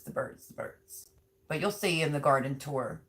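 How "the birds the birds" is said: breathless creepy whisper; hushed mock-dramatic "the birds, the birds"; spooky horror-movie whisper. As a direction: whisper it like a horror film warning. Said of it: "that person that's like".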